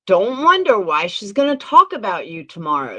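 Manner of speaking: usual speaking voice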